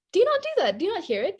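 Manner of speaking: high, emphatic do you not; defensive high question. Real, not acted. Candy asking her friend if she notices, high and emphatic. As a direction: pitch up, challenging her friend.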